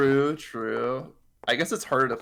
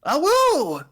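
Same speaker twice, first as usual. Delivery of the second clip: startled; 8 dB louder than usual. Acted. Startled by his name called.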